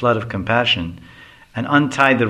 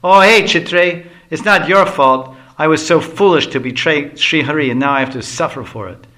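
higher, louder, aggrieved protest; a sulky Brahmin boy loudly complaining he's been wronged; angry outburst; 5 semitones higher, 5 dB louder than usual. Acted. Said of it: "getting angry".

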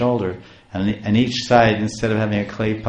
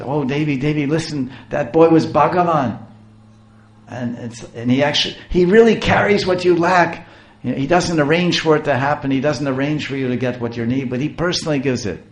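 higher and louder, rising to joy; ecstatic husband; 6 semitones higher, 4 dB louder than usual. A narrator's reading. Husband grabs his wife's hands to tell her, ecstatic. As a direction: announce the miracle to your wife with joy.

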